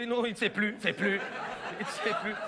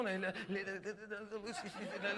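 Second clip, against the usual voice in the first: dazed mumble; 2 semitones lower, 11 dB quieter, much slower than usual. Acted. Bride dazed, lost.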